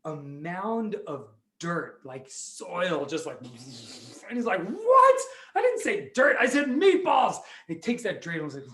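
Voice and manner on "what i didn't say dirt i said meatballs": high, loud cartoonish outrage; hammed-up exasperated complaint; indignant protest. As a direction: squawk in disbelief at the mistake. Neighbour outraged at getting dirt.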